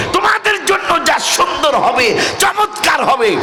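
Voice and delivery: high preacher's cry; soaring, emphatic promise; rousing proclamation. Acted. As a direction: ring it out high to rouse the crowd. Promise repeated at full force.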